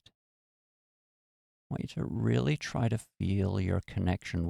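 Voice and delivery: calm, even meditation voice with long pauses; guided meditation. Acted. Host leading listeners through a relaxation meditation, calm and unhurried.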